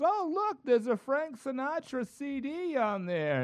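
put-on high, slow elderly woman voice; old lady voice. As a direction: play a confused old neighbor. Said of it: "and she goes".

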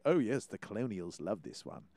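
low, soft mock-British voice; low-pitched imitation British gent; British accent. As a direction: put on a suave British accent, quietly. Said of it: "digging that British accent".

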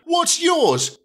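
very high mocking question; high sneer. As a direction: toss it off with scorn. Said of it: "James sneered".

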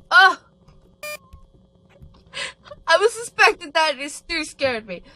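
loud high yelp of fright; real shocked scream; startled scream. Real, not acted. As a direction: jolt at a jump scare and blurt it out. Jump scare reaction.

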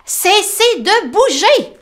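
high, slow, loud mole scold; Tom scolding. Acted. Tom to the wriggling rabbits, high slow scold. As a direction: order them to stay still.

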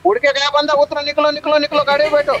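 high, insistent orders to the team; urgent calling out. Real, not acted. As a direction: hurry everyone along, voice pitched right up. Urging team into the car. (ऊँची आवाज़ में हड़बड़ाकर पुकारना)

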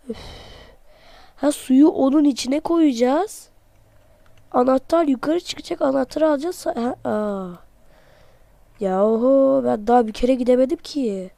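normal talking voice

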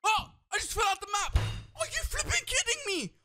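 very high, shouted disbelief; shocked shout. Real, not acted. Gamer falling out of the map, high shocked shout. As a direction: panic as he falls out of the world.

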